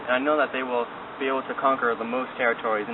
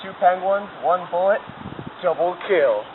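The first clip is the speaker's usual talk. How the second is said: excited bragging; 5 semitones higher than usual. Acted. Bragging about a hunt.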